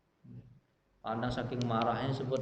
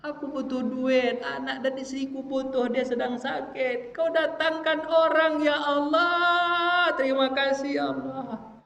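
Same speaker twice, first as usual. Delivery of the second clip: higher, slow, singsong crying plea; tearful prayer; 10 semitones higher than usual. Retold or imitated. Poor man weeps his thanks to God, singsong. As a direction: pray as if weeping with gratitude. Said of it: "menangis kepada Allah".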